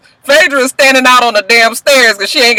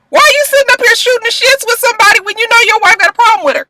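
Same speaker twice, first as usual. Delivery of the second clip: exasperated rant; 13 semitones higher, faster than usual. Real, not acted. Ranting at Apollo.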